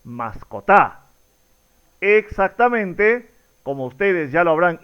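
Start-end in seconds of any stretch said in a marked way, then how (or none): 0.0-1.0 "mascotá" slow, stressed on the last syllable, TA; drawn-out "mascotá" accented at the end; misplaced final stress. Shown as a demonstration. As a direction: land on final TA in "mascotá", dropping lower. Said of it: "o la pronunciación correcta es".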